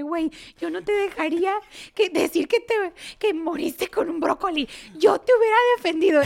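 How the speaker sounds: high, loud mock-indignant friend; friend's indignant voice. Retelling how her friend Carmen defended her, high indignant voice.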